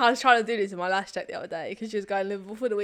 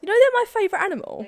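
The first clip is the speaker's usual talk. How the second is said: excited exclaim; 11 semitones higher, 10 dB louder, faster than usual. Real, not acted. Surprised friend protests.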